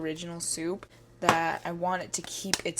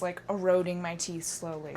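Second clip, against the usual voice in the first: ordinary talk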